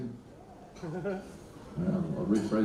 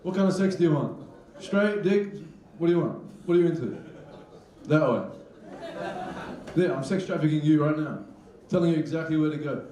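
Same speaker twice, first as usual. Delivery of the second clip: traffic controller; 7 semitones higher than usual. Pretend. Comic imagining a sex-trafficking traffic controller, hammed-up directing. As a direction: wave people through like a traffic cop. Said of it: "thinking of a traffic controller".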